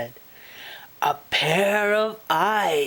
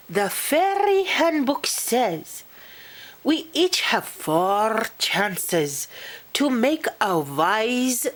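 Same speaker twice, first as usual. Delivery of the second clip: touch of a know-it-all fairy; lightly voiced fussy fairy; 6 semitones higher than usual. Pish quotes the fairy handbook.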